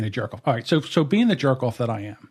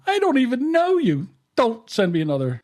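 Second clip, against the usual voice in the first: high-pitched indignant outburst; acting out an affronted reply; indignant retort; 11 semitones higher than usual. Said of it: "all of a sudden".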